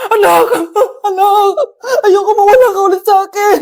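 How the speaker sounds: loud, high tearful cry; crying plea. Acted. Mother cries out as her child vanishes again, loud and tearful. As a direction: beg through sobs.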